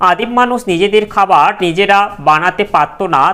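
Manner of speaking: ordinary talk, nothing marked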